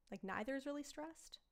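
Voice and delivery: ordinary talk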